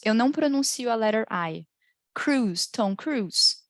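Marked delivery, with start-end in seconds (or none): none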